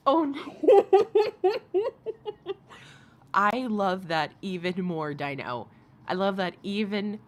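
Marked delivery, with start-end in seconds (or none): 0.1-2.5 much higher, louder laughing cry; high-pitched laughing. Real, not acted. Streamer cracking up at her own high pitch, laughing shrilly. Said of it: "High pitch".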